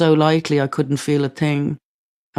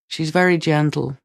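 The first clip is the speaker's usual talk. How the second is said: usual speaking voice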